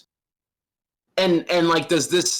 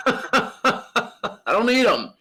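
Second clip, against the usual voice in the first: high, slow, laughing mock declaration; laughing while joking; laughing through it; 6 semitones higher, slower than usual. Real, not acted. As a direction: giggle out the words. Friend mocking game stories.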